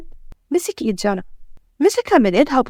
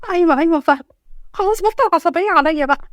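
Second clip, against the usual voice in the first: higher-pitched, cowed agreement; flustered submission; 6 semitones higher than usual. Acted. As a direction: give in quickly to calm an angry husband. Cowed wife answers angry husband. (استسلام بتوتر)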